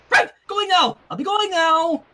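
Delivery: high flustered goodbye; flustered Twilight. Acted. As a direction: scramble to leave, flustered. Twilight hastily leaving.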